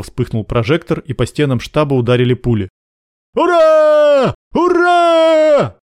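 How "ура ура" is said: long, high, loud hurrah; charging guards' drawn-out yell; yelling battle cry. A narrator's reading. Guards running at the headquarters under fire, yelling. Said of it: "завопили охранники".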